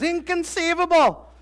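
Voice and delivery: high mock-outraged Inconceivable; Vizzini exclaiming. Preacher imitating Vizzini's indignant cry. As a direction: squeal it in disbelief. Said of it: "Vizzini goes".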